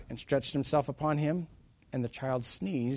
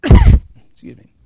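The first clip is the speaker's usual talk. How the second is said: usual speaking voice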